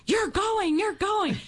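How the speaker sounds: high, excited imitation of the helper; joyful announcement. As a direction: voice her thrilled news up high. Woman announces the good news.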